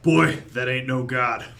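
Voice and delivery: loud, slow gruff character voice; gruff, drawn-out rebuke. Acted. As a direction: say it gruffly, slowly and with force. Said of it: "turns to you and gruffly says".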